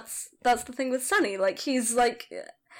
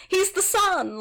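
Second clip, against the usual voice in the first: raised, high-pitched proclamation; mock shouting; 7 semitones higher, slower than usual. Co-host hyping up the favoured son, mock shouting. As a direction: proclaim it high, playfully over-keen. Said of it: "I'm just shouting".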